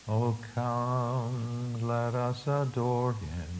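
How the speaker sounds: hushed, slow reading of the hymn line; quiet spoken hymn words; soft spoken quote. Shown as a demonstration. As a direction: speak it softly, like a prayer. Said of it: "Will you sing that with me?".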